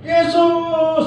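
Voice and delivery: high, sustained call; acted long high cry. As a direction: holler the name after someone walking on. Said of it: "Kemudian mereka teriak lagi".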